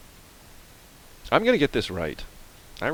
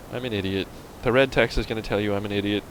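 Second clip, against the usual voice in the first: usual speaking voice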